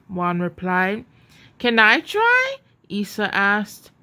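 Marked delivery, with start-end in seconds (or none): 1.6-2.6 put-on high, eager child's question; a little girl asking her cousin if she can try, high and eager. A narrator's reading. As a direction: ask like an excited little cousin.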